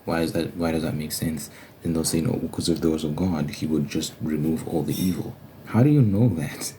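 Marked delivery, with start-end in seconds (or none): none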